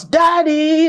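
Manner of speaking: high call to dad across the field; calling from afar. Acted. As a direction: shout it out to a distant father. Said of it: "the boy finally shouts".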